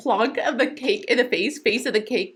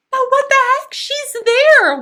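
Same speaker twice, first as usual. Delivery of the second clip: high, loud, startled delight; surprised outburst; 10 semitones higher, 6 dB louder, slower than usual. Real, not acted. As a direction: burst out in disbelief. Delighted surprise at officiant appearing.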